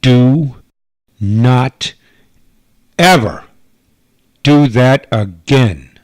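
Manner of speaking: loud, slow, each word hammered; furious warning. Acted. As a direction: lay down the law, word by word. Agent furious after the kiss.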